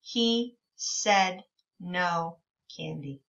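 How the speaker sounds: slow, even read-along pace; slow reading together. Shown as a demonstration. Teacher reading along slowly with the children. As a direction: lead the class through it slowly. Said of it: "Can you read it with me?".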